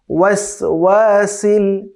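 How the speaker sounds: ওয়াসওয়াসিল with each স crisp and drawn out; ওয়াসওয়াসিল slowly with careful sin; clear sin sounds. Shown as a demonstration. Teacher stresses the sin letters for students. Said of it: "খেয়াল করুন সিনের উচ্চারণগুলো".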